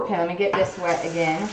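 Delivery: ordinary talk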